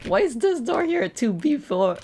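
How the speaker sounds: usual speaking voice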